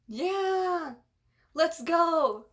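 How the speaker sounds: high, drawn-out cheer; acted high-pitched send-off shout; rousing cry. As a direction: call it out to the crew. Voices characters setting off.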